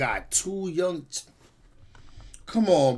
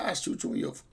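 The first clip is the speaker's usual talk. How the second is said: imitating the cop; 9 dB quieter, faster than usual. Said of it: "you hear the police talk like that?".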